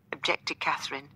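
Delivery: ordinary delivery, nothing marked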